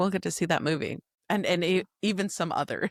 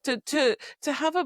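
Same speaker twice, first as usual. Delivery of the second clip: usual speaking voice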